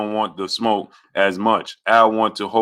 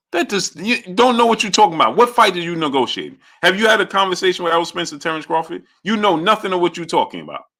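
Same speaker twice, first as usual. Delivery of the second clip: high-pitched, heated challenge; indignant rebuke; 6 semitones higher than usual. Real, not acted. Streamer telling off a viewer who knows nothing, heated and high. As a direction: challenge the critic point by point.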